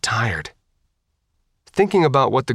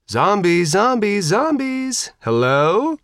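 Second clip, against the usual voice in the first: taunting call; 10 semitones higher, slower than usual. A narrator's reading. Girl banging on hatch, taunting.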